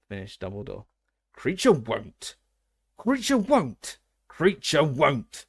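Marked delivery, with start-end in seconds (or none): 1.3-5.4 slow, loud croak that leaps higher; croaking elf. A narrator's reading. The elf refusing the Potter boy, croaking slow and loud. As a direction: croak it out like a stubborn house elf. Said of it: "croaked the elf".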